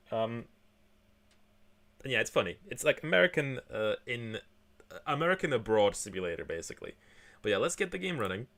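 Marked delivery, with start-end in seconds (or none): none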